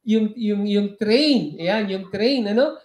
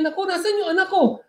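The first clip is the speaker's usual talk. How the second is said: panicked father; 8 semitones higher, faster than usual. Acted. Father searching for son.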